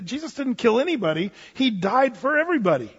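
ordinary talk, nothing marked

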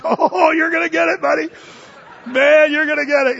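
high, loud gleeful taunt; teasing taunt. Acted. Viewer laughing at the slacker on TV, high loud gleeful taunt.

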